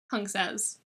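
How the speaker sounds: usual speaking voice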